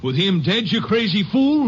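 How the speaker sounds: high, loud disbelieving yell; outraged shout. Acted. Bootlegger yelling at the constable over the killing. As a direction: blow up at him in disbelief.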